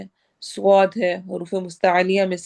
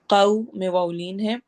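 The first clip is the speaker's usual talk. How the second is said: normal talking voice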